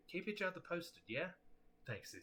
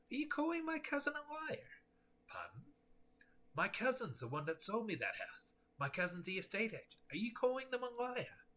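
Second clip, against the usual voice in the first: put-on suspicious old homeowner; 9 semitones higher than usual. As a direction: snap at the caller. Flint takes offence.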